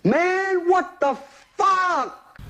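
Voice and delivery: high, slow loud shout. Acted. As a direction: blow up in disbelief.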